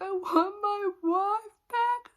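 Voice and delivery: mocking, drawn-out crybaby wail; mock sobbing. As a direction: imitate him blubbering for his wife. Husband crying, retold.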